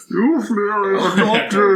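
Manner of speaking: put-on muffled old grandfather voice; hand over mouth. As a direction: talk through a hand covering the mouth. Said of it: "my grandfather voice with my hand over my mouth".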